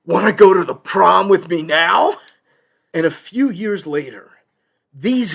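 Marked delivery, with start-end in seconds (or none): 0.1-2.2 loud crowing challenge; triumphant taunt. Acted. Lawyer possessed by Farragut's ghost after winning, loudly triumphant. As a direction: throw it out as a bold, gloating question. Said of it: "possessed by the ghost of David Farragut".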